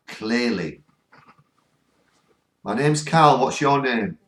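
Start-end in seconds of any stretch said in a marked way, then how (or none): 2.6-4.2 louder, higher, sure repeat of the spirit's words; confident louder echo. Retold or imitated. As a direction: say it out firmly, now you are sure. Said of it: "They come through again".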